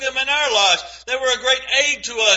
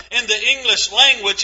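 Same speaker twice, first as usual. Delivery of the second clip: ordinary delivery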